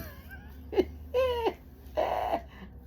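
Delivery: real high, wheezy laugh. Real, not acted. Storyteller tickled by her own imitation, laughing. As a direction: crack up helplessly at your own impression.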